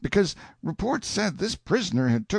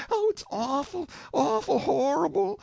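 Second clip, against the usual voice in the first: high, wailing cry of horror; a crowd breaking down in tears; 10 semitones higher than usual. A narrator's reading. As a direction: wail it out in shock. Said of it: "busted out wailing and crying and saying".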